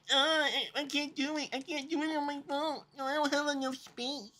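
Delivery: hushed, mocking impression of a bad excuse; mock whiny excuse. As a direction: imitate the lame excuse under your breath. Mimicking a camcorder pirate's excuse.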